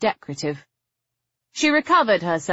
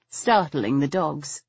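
plain reading voice

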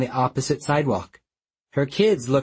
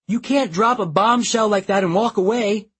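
yelled; 8 semitones higher than usual. Acted. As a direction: yell it at his back. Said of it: "I yelled".

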